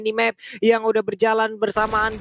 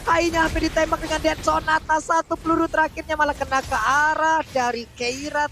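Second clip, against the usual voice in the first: excited play-by-play; 6 semitones higher than usual. Real, not acted. Live play-by-play of teamfight.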